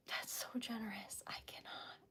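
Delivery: overcome, barely audible; whisper. Real, not acted. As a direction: let it fall to a whisper. Overwhelmed by gift, whispers.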